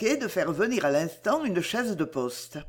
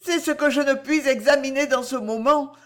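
higher, near tears; holding back tears; 6 semitones higher, 4 dB louder than usual. Acted. Cécile, barely holding back tears, answers Delville, higher. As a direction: fight the tears as you speak. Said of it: "en retenant à peine ses larmes".

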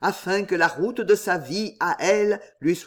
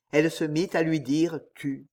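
ordinary delivery, nothing marked

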